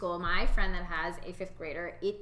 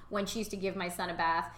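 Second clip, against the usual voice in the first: normal talking voice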